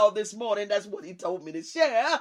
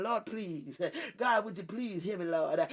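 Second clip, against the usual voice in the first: softer, lower whining plea, mocking; mock begging prayer; 6 semitones lower, 7 dB quieter than usual. Preacher mocking a churchgoer who only begs, soft whiny plea. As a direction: imitate someone begging God, pleading softly. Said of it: "Everything is about begging".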